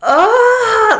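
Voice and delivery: high, loud wail of heartbreak; anguished cry. Retold or imitated. Viewer reacting to a heartbreaking scene, high anguished cry. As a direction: let out a pained, yelping cry. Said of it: "it's just so emotional".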